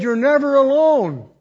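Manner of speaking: preacher declaring the promise full voice; proclaimed. Acted. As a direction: proclaim it loud and high. Quoting God's promise aloud.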